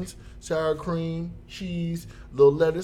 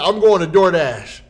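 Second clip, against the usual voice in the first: loud, done with it; fed-up loud threat; 4 semitones higher, 11 dB louder than usual. Retold or imitated. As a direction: snap it out as an ultimatum.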